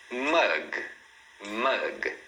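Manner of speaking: MUG closed on a hard G; clean final consonant. Shown as a demonstration. Teacher models word for repeating, correct pronunciation. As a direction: say "mug" with no vowel after G. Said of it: "Olha outro exemplo".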